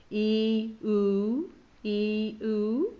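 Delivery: loud stretched "ee" then rounded "oo" alternating; lip warm-up vowels. Shown as a demonstration. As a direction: spread then round the lips on "ee" "oo". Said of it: "great warm-up exercise for the lips".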